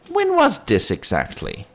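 high, questioning policeman; sceptical policeman. Acted. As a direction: probe her story as a doubting policeman.

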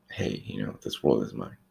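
mock entitlement, low and soft; understated, low-pitched mock self-importance; mock privileged mindset. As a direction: lightly imitate an entitled customer, dropping low and quiet. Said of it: "privileged mindset and be like".

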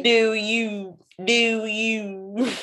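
put-on playful Baltimore accent; mock Baltimore accent. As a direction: keep riffing in a Baltimore voice. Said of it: "someone from Baltimore".

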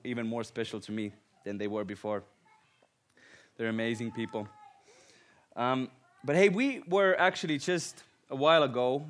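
ordinary delivery

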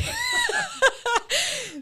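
high, shrieking real laugh; helpless laughter. Real, not acted. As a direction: crack up in one long, high peal. Cracks up at her own story.

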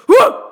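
high loud laughing whoa; mock scream. Friend imitating her scream at the movies. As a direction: yelp it like a startled moviegoer. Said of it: "she'd go".

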